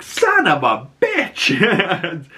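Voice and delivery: high, drawn-out comic cursing; hammed-up dismay; mock exasperation. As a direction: stretch the curse out, laughing through it. Team's retold reaction to failure.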